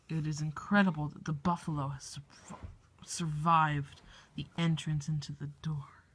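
hushed awed documentary whisper. Acted. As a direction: whisper it like a wildlife narrator. Documentary narrator whispers in awe.

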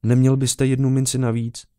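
put-on high-pitched child beggar; begging little boy. A narrator's reading. Leper boy begging the officer for a coin, high child's voice. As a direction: ask in a small boy's high voice. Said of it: "desetiletého schrbeného chlapečka".

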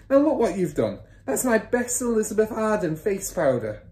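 high, upset scolding; mother's shriek. A narrator's reading. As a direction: scold him over the spilt powder. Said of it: "shrieked the mother".

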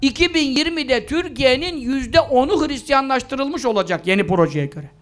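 normal talking voice

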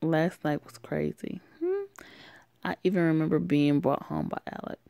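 ordinary talk, nothing marked

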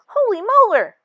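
high, loud shocked cry. Acted. Tooth hearing about its cavity, shocked exclamation. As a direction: gasp it out in total shock.

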